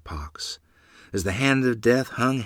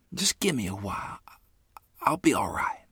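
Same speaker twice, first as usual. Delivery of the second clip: weak whisper; 3 semitones higher than usual. A narrator's reading. Sick mother reassures weakly.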